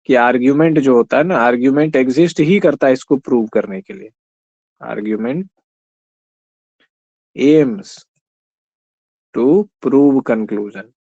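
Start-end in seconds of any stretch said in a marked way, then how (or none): 4.8-10.9 very slow, word by word with long gaps; slow dictation. Shown as a demonstration. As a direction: spell it out slowly for note-taking. Dictating a definition to students. (धीरे-धीरे लिखवाते हुए)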